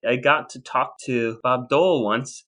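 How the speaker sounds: normal talking voice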